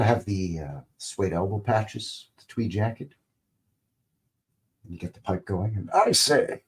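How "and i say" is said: put-on posh British accent. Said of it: "I always have to have a British accent when it's somebody that's sophisticated".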